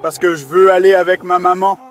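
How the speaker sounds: loud tearful wail; crying. Real, not acted. Suspect tells officer he wants his mother, crying. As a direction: bawl it out through real tears. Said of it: "Pourquoi tu pleures?".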